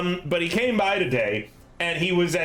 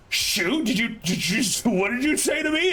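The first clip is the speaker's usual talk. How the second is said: offended landlord; 5 semitones higher than usual. Retold or imitated. Landlord offended, confronting tenant.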